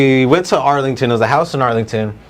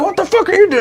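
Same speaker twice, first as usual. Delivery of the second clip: very high mock screech at moderate volume; retold screaming; faster than usual. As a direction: mimic the other man yelling back, voice pitched right up. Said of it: "she hears us screaming outside".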